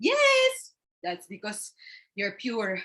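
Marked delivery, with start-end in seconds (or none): 0.1-0.7 excited. Acted. Excited sister.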